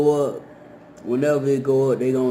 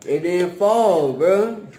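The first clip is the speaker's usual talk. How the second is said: higher, louder, slow disbelief; surprised relief; 5 semitones higher, 4 dB louder, much slower than usual. Real, not acted. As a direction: say it amazed it held up. Relieved something didn't fall.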